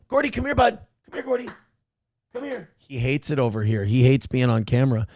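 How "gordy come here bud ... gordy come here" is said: high coaxing call to the dog. Real, not acted. Owner coaxing Gordy the dog over, high call. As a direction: coax the dog over, pitched up.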